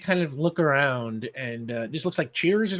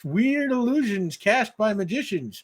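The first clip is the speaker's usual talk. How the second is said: slow, slightly raised know-it-all bar regular; know-it-all barfly; 7 semitones higher, 5 dB louder than usual. Acted. GM voices the mustachioed mailman at the bar, mild know-it-all drawl. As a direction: lecture the room like the bar's trivia buff. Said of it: "mustachioed mailman who's kind of like".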